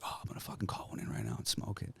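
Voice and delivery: excited hunting whisper, quick and breathless. Retold or imitated. Hunter hidden near a buck, whispering his plan quickly. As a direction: whisper it fast so the buck won't hear.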